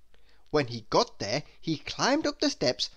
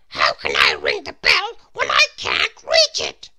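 tiny character voice, louder and airy; Mister Small's voice; 14 semitones lower, 9 dB louder than usual. Acted. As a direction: voice the little man with a strained, airy tone. Mister Small wonders at the bell.

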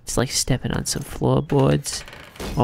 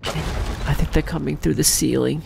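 ordinary delivery

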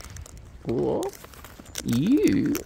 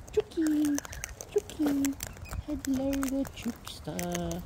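calling chickens; 12 semitones higher, 6 dB quieter, slower than usual. Real, not acted. High calls then whisper.

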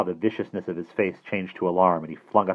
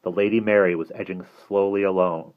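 plain reading voice

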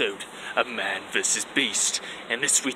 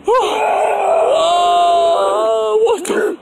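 alarm shout; 11 dB louder, slower than usual. Acted. Chased and bitten by Sasquatch.

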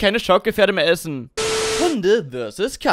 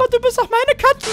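tiny high hurt voice; whiny little squeak; high squeaky voice. Acted. Besitzer hoch und piepsig. (hohe gekränkte Piepsstimme)

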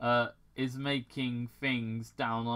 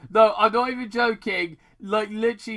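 1 emphatic insistence; 8 semitones higher, 10 dB louder than usual. Real, not acted. Insists the typo is real.

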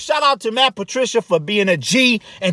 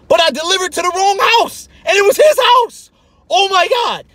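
excited shout; 9 semitones higher than usual. Real, not acted. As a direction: burst out with the punchline. Climax of his story.

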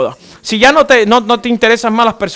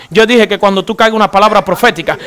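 ordinary delivery

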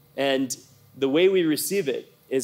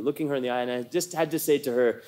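ordinary talk, nothing marked

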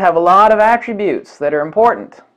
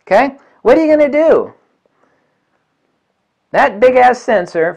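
ordinary delivery

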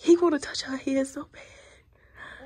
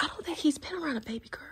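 fast whisper; 5 semitones higher, 10 dB quieter, much faster than usual. Real, not acted. Whispering beside the baby.

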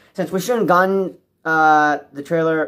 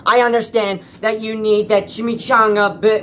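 ordinary talk

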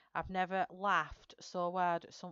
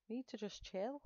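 softer, higher murmur to herself; quiet self-calming; 5 semitones higher, 6 dB quieter than usual. Real, not acted. Artist calms her own nerves while painting, softly to herself. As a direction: talk yourself down gently. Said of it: "I need to just chill".

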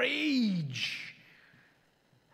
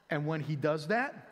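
ordinary delivery

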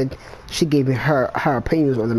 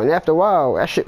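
usual speaking voice

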